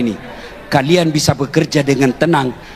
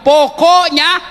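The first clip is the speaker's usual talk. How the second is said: drawn-out, higher, louder "Pokoknya" like a nagging wife; mimicking the wife; 11 semitones higher, 4 dB louder, much slower than usual. Preacher mocks a wife ending the argument, drawn out. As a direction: stretch "Pokoknya" slowly, mocking the wife's final word. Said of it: "Apalagi kalau dia sudah bilang".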